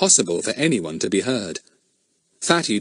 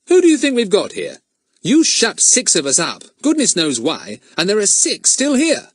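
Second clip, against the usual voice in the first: Fatty's bold retort; 11 semitones higher, 5 dB louder than usual. A narrator's reading. Fatty faces the kidnapper.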